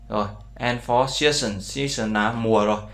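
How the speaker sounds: ordinary talk, nothing marked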